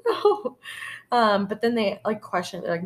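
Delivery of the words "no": high-pitched retold squeal of refusal; squeaky, comic protest; mock dismayed no. Retelling own reaction to doll.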